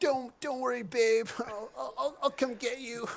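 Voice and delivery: high, loud, faltering promise of rescue; stammered calling out. Acted. Peach Fuzz calls to his kidnapped babe, loud stammered reassurance.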